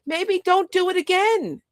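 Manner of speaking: normal talking voice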